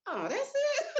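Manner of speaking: high, deflated oh that's it; relieved letdown. Retold or imitated. Friends hearing only foreclosure, high mock-relieved. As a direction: friends almost let down by the truth. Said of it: "they were like".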